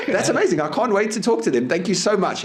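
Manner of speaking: high, gushing sarcastic thanks; mock delight. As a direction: gush as if thrilled by the threat. Mock delight at caller's threat.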